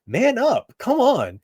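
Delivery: high, chiding call to man up; mock stern high-pitched scold. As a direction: scold them to toughen up. Scolding crying men.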